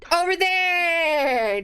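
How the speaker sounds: mock dramatic point. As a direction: ham it up like a disco pose. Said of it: "doing a disco move at this point, going".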